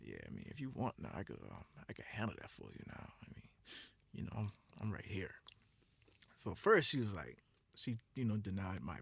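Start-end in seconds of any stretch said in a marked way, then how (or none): 0.0-5.3 deep, soft seductive murmur; low come-on voice into a whisper; sexy voice. Retold or imitated. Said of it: "Even with the voice?".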